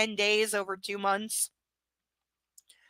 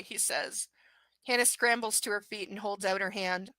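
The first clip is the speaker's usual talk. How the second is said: ordinary talk, nothing marked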